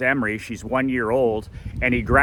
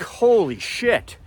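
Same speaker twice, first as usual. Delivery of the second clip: awed disbelief; 8 semitones higher, slower than usual. Retold or imitated. Seeing Lafleur at brunch.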